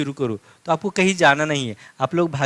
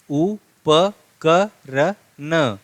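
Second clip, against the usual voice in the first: उपकरण stretched very slowly; drawn-out reading; much slower than usual. Shown as a demonstration. Teacher reading उपकरण aloud to students, very slowly. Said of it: "ऐसे ही करते हैं ना pronunciation".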